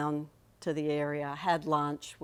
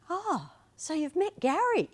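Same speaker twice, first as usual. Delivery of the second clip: much higher mimicked voice, bright and unsuspecting; high imitation of a hopeful aunt; imitating the aunt; 12 semitones higher than usual. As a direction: mimic the aunt, assuming it was Gary. Aunt's retold question.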